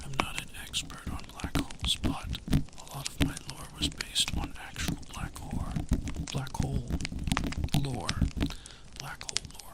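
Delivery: ASMR whisper. Acted. ASMR streamer to viewers about space, whispering. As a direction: whisper gently to soothe the listener.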